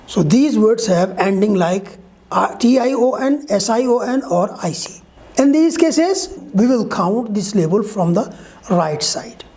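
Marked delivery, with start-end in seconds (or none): none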